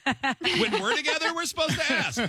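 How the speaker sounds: high, slow, laughing repeat; laughing disbelief. Real, not acted. Host laughing at the group's rule, slow high laughing disbelief.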